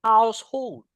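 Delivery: high, clear model words for the class to echo; repeat-after-me drill. Shown as a demonstration. Teacher leading the class in a repeat-after-me drill, high and clear. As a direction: call out each word to be repeated. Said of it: "repeat after me".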